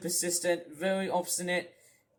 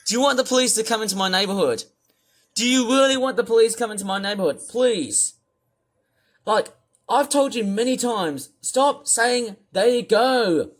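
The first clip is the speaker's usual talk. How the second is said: loud, exasperated scolding; angry rant; 4 semitones higher, 8 dB louder than usual. Real, not acted. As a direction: scold him, fed up and loud. Ranting at Otis.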